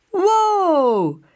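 high, loud whoop of delight; cheering. A narrator's reading. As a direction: shout for joy mid-slide. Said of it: "juicht hij".

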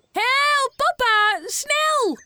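high, loud urgent cries; mock panic to prank papa; calling for help. A narrator's reading. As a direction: holler for papa to hurry.

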